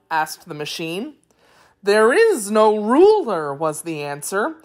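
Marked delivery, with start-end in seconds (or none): 1.8-3.5 slow, loud, swooping high voice; Wheeler creature. A narrator's reading. Wheeler answering the machine man, high singsong creature voice.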